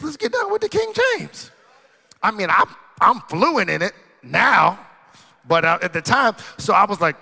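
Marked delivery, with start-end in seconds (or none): 0.0-1.5 high preached whoop. Acted. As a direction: voice leaps way up on King James. Preached punchline, pitch leaps high.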